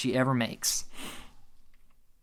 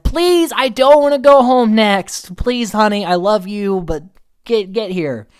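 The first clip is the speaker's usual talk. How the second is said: desperate pleading; 8 semitones higher, 7 dB louder than usual. Retold or imitated. Chris begging his fiance so he isn't sent home, loud desperate plea. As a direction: plead for your life, half yelling.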